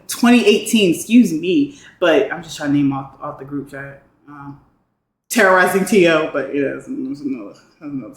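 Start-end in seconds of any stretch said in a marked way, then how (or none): none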